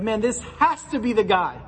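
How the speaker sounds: a lightly voiced, rising eager hope; disciples' hype. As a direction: voice the disciples certain this is the one. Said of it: "got in the mind frame of".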